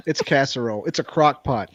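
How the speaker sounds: ordinary talk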